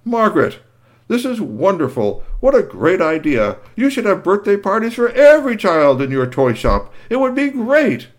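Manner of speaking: high, loud pompous praise; enthusiastic Doctor. Acted. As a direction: gush over the idea. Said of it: "he said".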